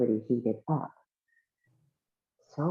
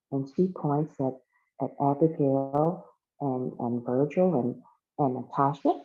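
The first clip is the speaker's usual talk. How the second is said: ordinary delivery, nothing marked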